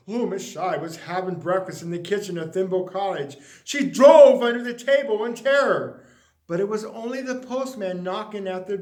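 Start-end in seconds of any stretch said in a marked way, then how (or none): none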